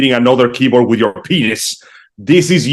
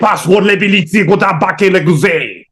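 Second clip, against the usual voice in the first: lightly put-on fake French accent; 5 semitones higher than usual. Joking caller playing a Frenchman, mock French accent. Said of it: "close enough to French".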